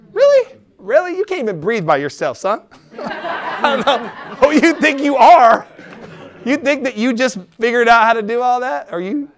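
high, loud, incredulous challenge; mock indignation. Preacher challenging people who own the place, mock indignant. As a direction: take the cocky one down, playfully and loudly.

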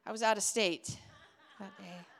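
a quiet giggle through a cheeky fib; hushed, amused little alibi; sly excuse. Retold or imitated. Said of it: "I'd be like".

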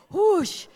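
loud, high blown-out whoosh; whoosh sound effect. Pretend. Imitating gale force winds from the bed covers, loud whoosh. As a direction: blow it out like a gust of wind.

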